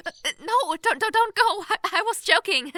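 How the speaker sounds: high, loud, frantic stammer; panicked plea. Acted. Princess begs the leaving stranger, panicked and loud. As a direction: grab at him before he goes.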